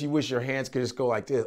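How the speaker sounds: ordinary talk, nothing marked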